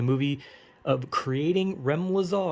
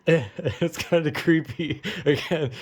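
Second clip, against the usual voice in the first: ordinary delivery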